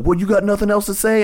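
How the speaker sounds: high mock challenge; hammed-up high taunt; taunting comic. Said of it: "she's like".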